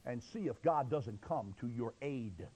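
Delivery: normal talking voice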